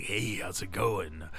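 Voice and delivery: low slow deep cool guy; cool raspy voice. Pretend. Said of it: "make my voice, like, super cool".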